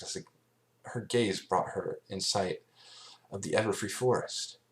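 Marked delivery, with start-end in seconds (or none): none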